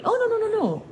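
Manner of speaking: high mock denial; quick denial. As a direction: squeak out a denial. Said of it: "she said".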